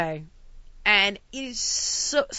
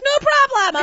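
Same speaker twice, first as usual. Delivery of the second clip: high clerk voice; 7 dB louder, slower than usual. Retold or imitated.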